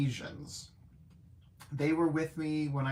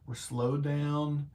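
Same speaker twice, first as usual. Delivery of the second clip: usual speaking voice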